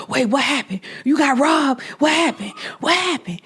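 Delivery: high, urgent, worried questions; mock panicked father; alarmed stepdad. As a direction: imitate a startled stepdad jumping up. Stepdad alarmed, voiced in retelling.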